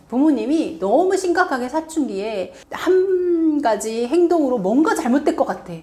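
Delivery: usual speaking voice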